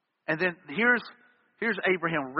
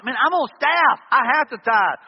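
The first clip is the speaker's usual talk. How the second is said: raised, higher voice, arguing back hard; heated retort; 5 semitones higher, 6 dB louder than usual. Retold or imitated. Youth pastor insisting to his wife he must tithe, raised and heated. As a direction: push back loud like it's the old fight again. Retold tithing argument with wife.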